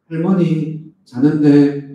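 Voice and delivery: put-on Gyeongsang accent, loud and slow, 자는데 drawn out; a child answering in thick Gyeongsang dialect; Gyeongsang-accented child on the phone. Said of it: "이렇게 이제 경상도 말로".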